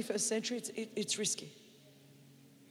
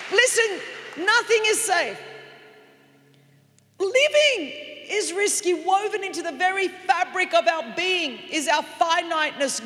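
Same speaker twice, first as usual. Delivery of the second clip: loud, rising sermon shout; full-force preaching; 3 semitones higher, 8 dB louder than usual. Acted. Preacher to congregation, loud preaching shout. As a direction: preach it out to the whole church.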